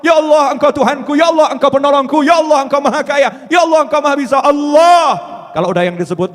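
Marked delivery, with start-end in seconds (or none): 0.0-5.0 loud, raised devotional cry; impassioned prayer, higher than usual talk; fervent invocation. Acted. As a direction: call out to God with building force.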